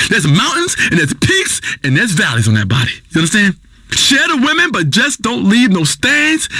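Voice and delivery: usual speaking voice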